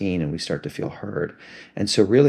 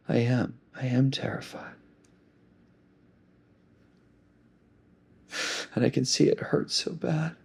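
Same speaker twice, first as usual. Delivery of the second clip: put-on much higher, softer hurt little child; the inner child admitting its fear to the caring adult; 6 semitones higher, 6 dB quieter than usual. Shown as a demonstration. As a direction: let the small scared kid answer back. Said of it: "it's a child voice".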